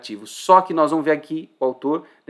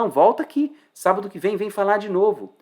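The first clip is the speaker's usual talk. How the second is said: higher-pitched, insistent calling back; lively acted-out plea; eager invitation; 6 semitones higher, 3 dB louder than usual. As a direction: urge them eagerly to come back. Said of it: "o que que ele falou?".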